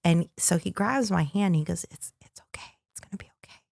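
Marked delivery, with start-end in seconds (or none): 1.9-3.6 barely audible, soothing whisper; hushed retold reassurance; reassuring whisper. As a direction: imitate a groom whispering comfort. Groom soothing upset bride, retold.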